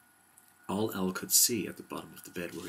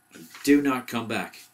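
loud, raised-pitch warning; crying out; 4 semitones higher, 7 dB louder than usual. A narrator's reading. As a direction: call a firm warning after the guest. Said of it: "cried Owl".

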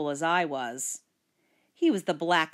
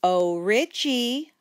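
slow, higher, drawn-out sweet call; mock-sweet singsong coo, louder and slow; 5 semitones higher, 5 dB louder, slower than usual. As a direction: coo the name to bait him. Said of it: "I cooed".

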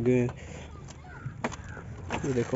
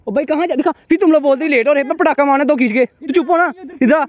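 loud, very high-pitched shouting; angry yelling; 12 semitones higher, 8 dB louder than usual. Real, not acted. Vlogger ordering a late companion to shut up, yelling. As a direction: yell them down.

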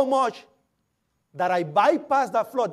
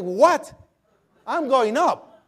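defiant retort; 5 semitones higher, 6 dB louder, much slower than usual. Retold or imitated. Defying the officer's order.

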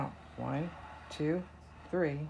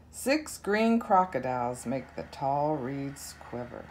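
plain reading voice

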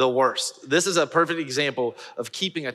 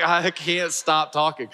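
higher, drawn-out helpless protest; loopy helplessness; 4 semitones higher, slower than usual. Retold or imitated. Loopy man admitting to Jessica he can't stop, helpless. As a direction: stretch it out slowly, like someone loopy and unable to stop. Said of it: "feeling kinda loopy and odd".

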